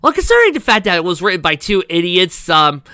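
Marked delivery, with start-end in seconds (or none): none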